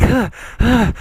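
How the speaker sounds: imitating heavy panting. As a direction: puff out short gasps. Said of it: "I'd just be like".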